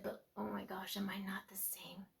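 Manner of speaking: soft, unvoiced self-questioning; whispered realization. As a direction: breathe it out in quiet shock.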